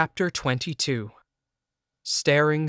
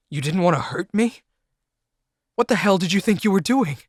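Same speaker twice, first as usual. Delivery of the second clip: higher, louder furious questioning; angry confrontation; 7 semitones higher, 4 dB louder than usual. Acted. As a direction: demand an answer, seething. Confronting fiancée in anger.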